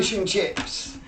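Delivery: squeaky high, loud mock English accent; cartoonish fake English accent, way up high; bad English accent. Piling on the joke with a silly English accent. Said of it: "purposely doing a bad English accent".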